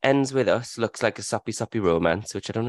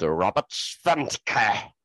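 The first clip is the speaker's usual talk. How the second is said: slow, lightly put-on German accent; mock German accent; much slower than usual. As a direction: say the name with a hint of German. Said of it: "Why do I always do a racist accent when I do German".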